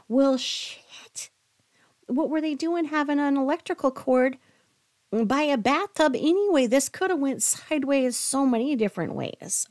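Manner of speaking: incredulous. Real, not acted. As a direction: react in loud disbelief. Reacting to absurd detail.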